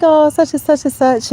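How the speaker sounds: put-on high, gushing coo over a cute child; high delighted gushing; gushing over kids. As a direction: coo like seeing a sweet baby. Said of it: "And I was just like".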